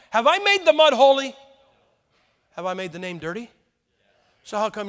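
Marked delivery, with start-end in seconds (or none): 0.1-1.3 much louder, higher rhetorical cry; preaching climax. Real, not acted. Preacher pressing the congregation on holy names, loud. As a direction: hammer the question at the congregation.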